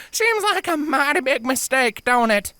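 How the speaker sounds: high country filly, nervous. Acted. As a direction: joke weakly while scared. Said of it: "did nothing to hide her building terror".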